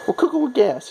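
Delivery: high repeat. Acted. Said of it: "as they say".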